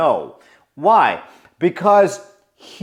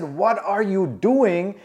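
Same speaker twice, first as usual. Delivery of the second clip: slow questioning; 6 semitones higher, slower than usual. Retold or imitated. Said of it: "No. I said".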